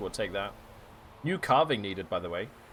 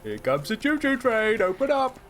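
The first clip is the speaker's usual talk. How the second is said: put-on much higher, louder sing-song baby talk; mock parent feeding baby; 9 semitones higher, 3 dB louder than usual. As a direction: coax a toddler to eat, teasing. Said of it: "making you eat in your high chair".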